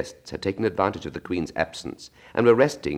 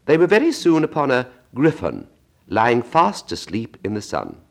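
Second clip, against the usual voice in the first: ordinary delivery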